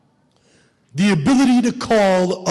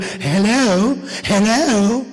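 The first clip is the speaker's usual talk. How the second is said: puzzled British "hello", rising; imitated British phone voice; British accent; 2 semitones higher, much slower than usual. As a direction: answer the phone as a confused Brit. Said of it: "British voice".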